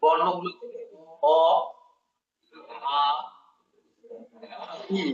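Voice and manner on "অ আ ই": অ, আ, ই each alone with long pauses; teacher listing অ, আ, ই slowly; vowel recitation. Shown as a demonstration. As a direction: spell out অ, আ, ই one by one. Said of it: "কি কি পেলাম".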